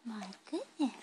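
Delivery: usual speaking voice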